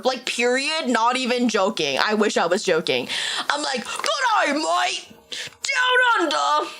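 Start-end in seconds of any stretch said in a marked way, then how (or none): 3.8-6.7 hammed-up fake Australian, high and drawn out; a badly faked Australian accent, slow at the end; mock Australian greeting; bad Aussie accent. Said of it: "That's so not Australian".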